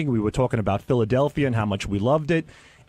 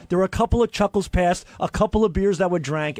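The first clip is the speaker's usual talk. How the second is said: usual speaking voice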